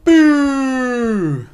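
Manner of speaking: a high, loud jeering boo; mock boo. MP giving thumbs down, loud mocking boo. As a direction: jeer like a panto crowd. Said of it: "And he goes".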